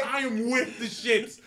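with stretched out and slow; stress on with. Real, not acted. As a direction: lean on the word with, drawing it out. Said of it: "repeated for emphasis".

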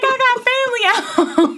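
real laugh breaking into the answer. Real, not acted. Laughing at interview question.

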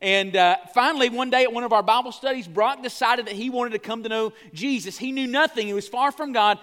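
normal talking voice

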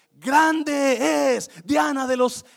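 loud, high, drawn-out chant; crowd shouting. Acted. Mob shouting for Diana of the Ephesians, chanting shout. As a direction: shout it slowly like a crowd in one voice. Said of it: "¿Qué gritaron?".

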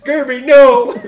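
shouted, high and laughing; loud anguished cry. Acted. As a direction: cry out in mock horror. Player cries out over Gerby.

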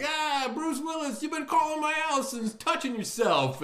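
blustering senator. Retold or imitated. Senator accusing Bruce Willis, high mock bluster.

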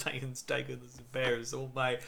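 quiet, mock Russian-accented line; bad Russian accent. Co-host mimics the film's heroine quoting Oz, bad Russian accent. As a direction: imitate an actress's bad Russian accent, softly. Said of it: "profoundly demonstrating her bad accent".

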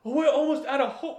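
the Chinese woman mock-lamenting at the diorama; mock despair. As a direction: wail it like a soap heroine. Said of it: "And she goes".

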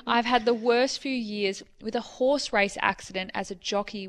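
ordinary talk, nothing marked

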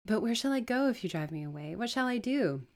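desperate pleading. Acted. Homeless woman begging at the door, pleading. Beggar pleads not to be turned away.

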